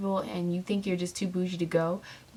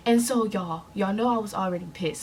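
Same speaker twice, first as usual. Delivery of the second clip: normal talking voice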